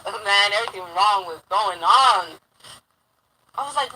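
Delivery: usual speaking voice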